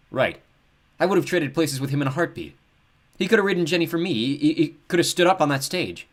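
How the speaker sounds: frustrated outburst. Acted. Luke snorts, frustrated outburst.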